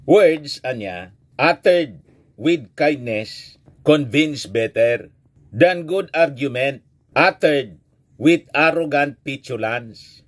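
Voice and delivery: put-on slow, weighty saying of an old proverb; slow proverb recital. Shown as a demonstration. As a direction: read it out slowly and deliberately, so it sinks in. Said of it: "tandaan po ninyo ito". (mabagal at mabigat na pagbigkas ng kasabihan)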